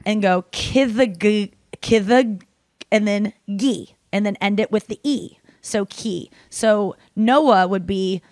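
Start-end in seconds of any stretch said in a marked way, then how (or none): 0.5-2.4 kithag said KIH-thag, slowly; sounding out kithag. Shown as a demonstration. Teaching gibberish, slowly building the syllable kithag. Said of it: "take a k ... and go".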